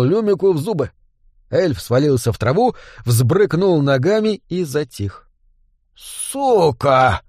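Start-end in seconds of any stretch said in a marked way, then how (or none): 5.9-7.2 growled curse leaping up to a high shout; enraged outburst; angry growl. A narrator's reading. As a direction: growl it in fury, building to a shout. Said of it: "зарычал Джо".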